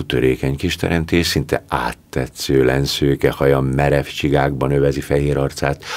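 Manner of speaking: ordinary delivery, nothing marked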